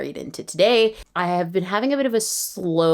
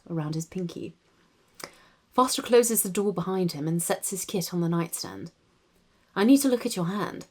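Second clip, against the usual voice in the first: soft, measured RP narration; RP accent; 4 dB quieter than usual. Shown as a demonstration. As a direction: read it quietly in an RP English accent. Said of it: "[name] does the R P accent".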